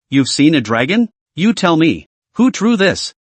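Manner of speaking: plain reading voice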